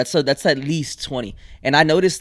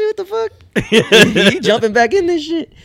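high, loud words broken by real laughter; laughing disbelief; 14 semitones higher, 7 dB louder, slower than usual. Real, not acted. Friend teased about jumping back in, laughing hard.

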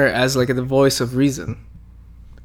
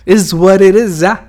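mock catchphrase; 4 semitones higher, 6 dB louder than usual. Joking repeated catchphrase.

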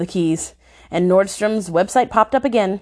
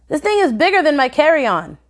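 incredulous exclamation; surprised husband exclaiming; 7 semitones higher than usual. A narrator's reading. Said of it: "Rob exclaimed".

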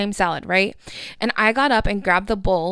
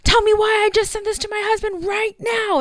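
very high-pitched mock freak-out; hammed-up high-pitched disbelief; 13 semitones higher, 3 dB louder than usual. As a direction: imitate her freaking out, pitch way up. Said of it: "she freaked out and she was like".

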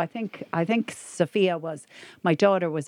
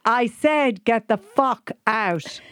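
put-on insistent toddler; 4 semitones higher, much slower than usual. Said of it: "but he continued".

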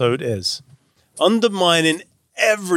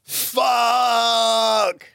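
anguished shout; 9 semitones higher than usual. Shown as a demonstration. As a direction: howl the word like it hurts. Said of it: "So one more time".

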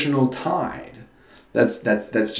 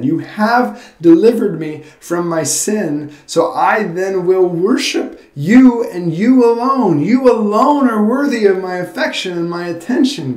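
fervent gratitude; 8 semitones higher, 6 dB louder than usual. Shown as a demonstration. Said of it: "a heart of gratitude that says".

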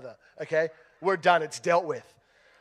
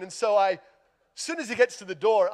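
usual speaking voice

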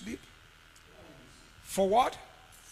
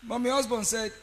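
usual speaking voice

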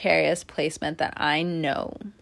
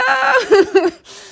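high burst of laughter; 8 dB louder than usual. Real, not acted. Laughs at crying Sag.

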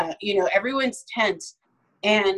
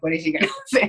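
mock thought, laughing; 3 semitones lower, faster than usual. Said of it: "like, a closed eye".

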